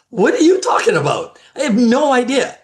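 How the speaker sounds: high, loud, incredulous; can't believe what he's hearing; baffled disbelief. Retold or imitated. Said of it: "And I'm like".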